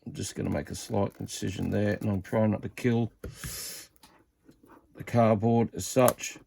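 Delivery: normal talking voice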